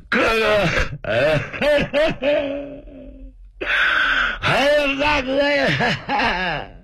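hammed-up wailing, drawn out and high; mock wailing. Tian Ba wails at his sworn brother to make him speak. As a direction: wail like a sulking little brother. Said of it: "田八爷更有绝活，你不说话，不理我，我哭".

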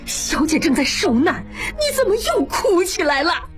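high, agitated reproach; scolding. A narrator's reading. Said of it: "李妈赶过来责备". (提高声调责备)

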